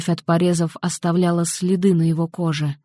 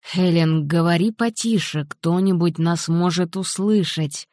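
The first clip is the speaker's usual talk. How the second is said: ordinary delivery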